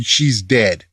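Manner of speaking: ordinary talk, nothing marked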